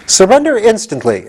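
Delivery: frown and order it; stern command. Acted. General Ginger orders the gatekeeper, high and stern. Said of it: "frowning as terribly".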